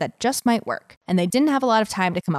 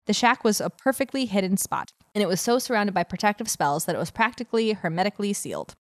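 ordinary delivery, nothing marked

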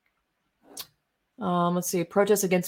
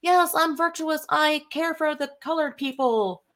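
mock virtue signaller; 10 semitones higher than usual.

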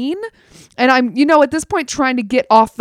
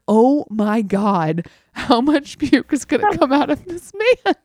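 disbelieving dismay; 4 semitones higher than usual. Retold or imitated. Disgusted at endless vomiting.

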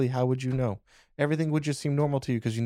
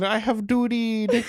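imitating a little kid; 9 semitones higher, 4 dB louder, slower than usual. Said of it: "running around the house going".

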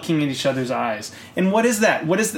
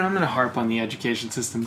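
usual speaking voice

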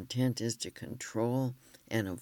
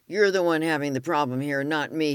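fast, loud, furious yelling back; retold yell; 4 semitones higher, 9 dB louder, faster than usual. Husband yelling at the therapist, loud and fast. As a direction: fire the blame straight back at her. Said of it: "he yelled".

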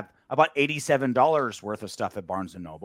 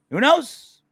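excited; 7 dB louder than usual. Real, not acted. Hopeful playoff excitement.

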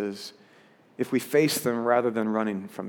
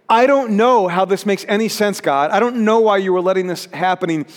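raised, emphatic plea to God; crying out; 5 semitones higher, 5 dB louder than usual. Acted. Preacher voicing Jesus' painful doubt to God, crying out. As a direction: protest in a higher, insistent voice. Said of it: "Jesus found himself crying out".

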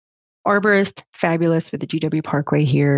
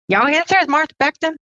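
lightly imitated Western Maryland accent, pitched much higher; hint of a Western Maryland colleague's twang, higher; 12 semitones higher than usual. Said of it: "I can't do the accent well".

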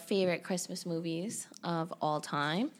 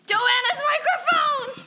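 high, loud, slow delighted shout; excited shout; 8 dB louder, slower than usual. Real, not acted. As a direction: shriek it with delight, laughing. Opening a gift she loves.

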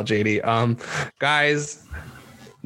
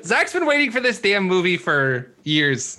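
laughing; 6 semitones higher, slower than usual. Real, not acted. Host teasing while laughing.

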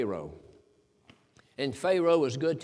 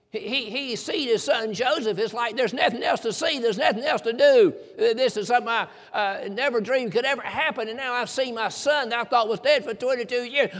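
higher, joyful voice with a laugh breaking through; beaming with overflowing happiness, louder at the end; joyful old father; 6 semitones higher, 4 dB louder than usual. Retold or imitated. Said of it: "He wants to die because he's happy".

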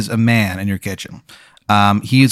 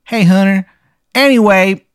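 high-pitched Maryland greeting; terrible Maryland accent; 9 semitones higher, 4 dB louder than usual. Acted. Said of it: "and again, his accent".

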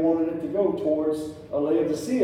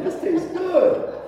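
much higher, laughing delight; excited; 5 dB louder, slower than usual. Retold or imitated. Father telling son the iced coffee is great, excited. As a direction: gush over the coffee. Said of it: "I'm like".

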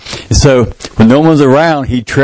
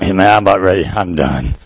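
exhausted; 8 semitones lower, 4 dB quieter than usual. Retold or imitated. Exhausted Rocky quoted.

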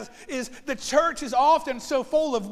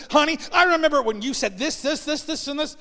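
higher, louder mock accusing wife; wife's reproach; 5 semitones higher, 5 dB louder than usual. Wife listing husband's old words, lightly mock accusing. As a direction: list his faults in a hint of a nagging wife. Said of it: "she looks at me and says".

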